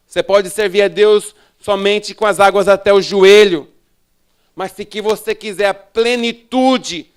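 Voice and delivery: forceful pulpit delivery, hammering each phrase; full-force preaching. Real, not acted. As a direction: preach it to the whole hall with mounting force.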